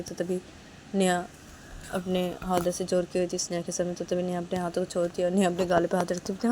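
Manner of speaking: plain reading voice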